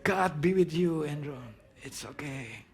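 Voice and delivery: soft, hammed-up fatherly comfort; calm a crying son while holding emotion back; gentle, drama-laden reassurance; restrained soothing. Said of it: "saya coba tahan emosi saya".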